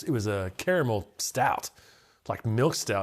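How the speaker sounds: ordinary delivery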